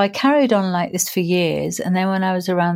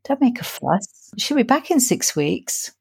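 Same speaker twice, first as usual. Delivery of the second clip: lightly voiced dismissive voice of reason, a little higher; logical inner voice; 4 semitones higher than usual. Logical brain talking her out of a hug, lightly dismissive. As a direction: mildly brush it off as no big deal. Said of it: "my logical brain popped up and said".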